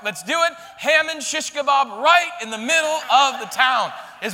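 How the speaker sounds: loud, high-energy sermon delivery; preaching at full force. Acted. Pastor celebrating Esther's victory to the church, full-force preaching. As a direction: preach the triumph to the back row. Preacher tells the climax loudly.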